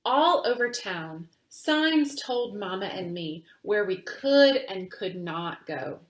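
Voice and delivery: plain reading voice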